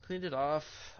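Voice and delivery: ordinary talk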